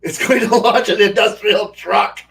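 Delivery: laughing loudly through the words; loud laugh breaking through the line. Real, not acted. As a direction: crack up while saying it. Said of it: "that's horrible".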